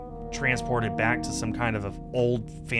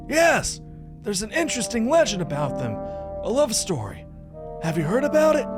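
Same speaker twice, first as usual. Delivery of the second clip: thrilled companion character; 11 semitones higher than usual. Acted. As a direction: squeal with amazement as the companion.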